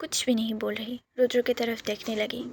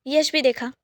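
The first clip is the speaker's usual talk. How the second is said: plain reading voice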